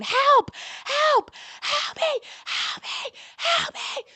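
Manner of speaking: screaming for help. Retold or imitated. Woman being beaten in a hallway screams for help. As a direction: scream for help as you're kicked. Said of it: "I'm screaming".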